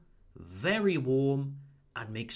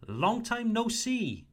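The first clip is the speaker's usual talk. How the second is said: "Long time no see" lifted high and bright with pitch; friendly greeting; 8 semitones higher than usual. Shown as a demonstration. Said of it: "If you want to greet someone".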